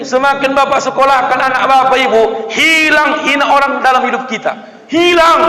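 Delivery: preacher shouting the lesson to the congregation; preaching shout. Real, not acted. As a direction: hammer the point home to the crowd. Preacher drives home his point.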